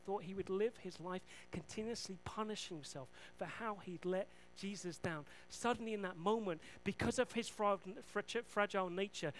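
ordinary talk

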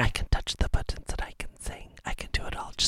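unvoiced mock-secret whisper. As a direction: breathe it like a secret. Whispered mock confession continues.

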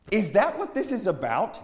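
high, loud, indignant question; angry challenge. A narrator's reading. Friend challenges the prophet's sermon, angrily. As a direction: throw it at him angrily. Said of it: "his friend said angrily".

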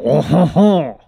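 mock French accent on a showy laugh. Shown as a demonstration. Co-host showing how to sound French, exaggerated French laugh. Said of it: "you just have to remember to go".

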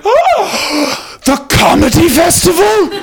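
very high, loud, drawn-out astonishment; thrilled Scottish squeal. Retold or imitated. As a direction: gasp and shriek it with Scottish delight. Driver's thrilled Scottish reaction acted out.

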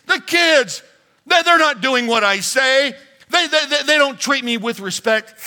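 high, loud, rushed wife's rant; complaining wife. Acted. Pastor voicing a wife venting to her husband, high and loud. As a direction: pour out the wife's grievances, high and fast.

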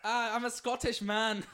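loud, high, drawn-out mock Scottish; hammed-up Scot impression; bad Scottish accent. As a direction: badly fake a slow Scottish accent. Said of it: "I can't do accents".

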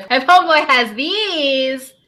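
rising, drawn-out "these"; mock excitement. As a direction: imitate her getting hyped over the shoes. Mimics clip, excited against quiet take.

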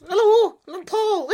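very high mocking caricature voice; Paul. Co-host mimics the host to his face, high-pitched mocking impression. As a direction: squeak out a silly take-off of your co-host. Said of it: "I'm doing my Paul voice now".

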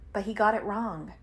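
usual speaking voice

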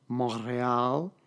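slow, hammed-up French immersion Montreal with a rolled R; exaggerated French accent. Ravinsky mocking French pronunciation, slow hammed-up French accent. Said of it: "with an exaggerated sort of French immersion rolled R".

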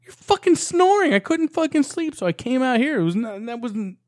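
high, fast, agitated justification; heated explaining. Retold or imitated. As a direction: argue your case at four a.m. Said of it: "and I was like".

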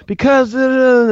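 mock wife. Husband mimicking his wife's huffy answer, high and loud.

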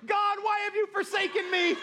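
high, loud, hammed-up lament; mock crying wail. Eight-year-old daughter in the car over forbidden chocolate, mock-crying wail. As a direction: wail it like a melodramatic child. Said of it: "she's just crying, and, you know, she's like".

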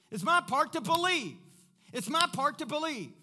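loud, high-pitched, driving sermon delivery; full-force preaching. Acted. Pastor rallying the congregation to believe God, full-force preaching.

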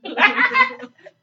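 high giggling laugh; burst of laughter. Real, not acted. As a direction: crack up laughing. Laughing at friend.

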